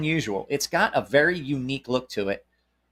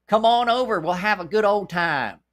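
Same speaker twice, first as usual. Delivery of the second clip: sweet hillbilly voice; 7 semitones higher than usual. Pretend. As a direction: call a friend over across the yard, hillbilly style. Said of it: "sweet hillbilly voice".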